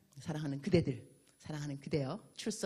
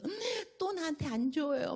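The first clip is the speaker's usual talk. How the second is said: whining; 10 semitones higher than usual. Retold or imitated. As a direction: whine it out like a sulky child. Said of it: "맨날 징징징징 거리면서".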